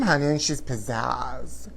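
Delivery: ordinary delivery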